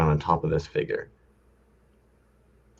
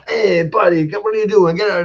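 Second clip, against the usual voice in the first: mock yell at drivers; 12 semitones higher, 8 dB louder than usual. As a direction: ham up a buddy barking at other cars. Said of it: "all of a sudden he's like".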